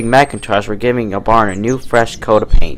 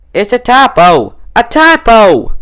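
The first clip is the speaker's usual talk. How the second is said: high, loud cry, louder on the repeat; exasperated exclaiming; 12 semitones higher, 5 dB louder, slower than usual. A narrator's reading. Applejack protests to the clerk, exasperated and loud. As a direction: shout it in exasperation. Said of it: "Applejack exclaimed".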